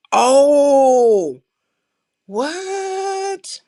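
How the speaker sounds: high, drawn-out disbelieving what; shocked reaction. Real, not acted. Streamer hearing someone was attacked, stunned. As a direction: let the what rise up stunned.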